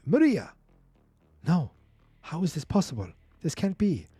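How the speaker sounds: high-pitched stunned father; acted disbelief. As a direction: voice a father's shock, lightly dramatized. Father sees captive daughter in retelling.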